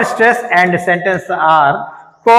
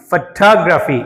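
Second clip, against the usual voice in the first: Photography with a weak opening and strong second syllable TOG; stress on second syllable; much slower than usual. Shown as a demonstration. Teacher modelling for students, second syllable of photography stressed. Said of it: "దీనికి stress వస్తుంది".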